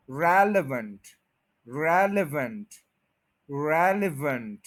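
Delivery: Relevant with the first syllable रेल stressed; relevant, first syllable रेल strong, rest light; stress on REL. Shown as a demonstration. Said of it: "इस पे stress है".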